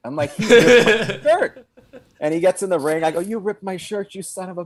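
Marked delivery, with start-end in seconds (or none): 0.3-1.7 high, loud, slow outraged cry through a laugh; slow shrieking outrage, half laughing; real outraged yell. Retold or imitated. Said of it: "But I really mean it". 3.2-4.6 playing-up outrage. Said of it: "I'm playing into it".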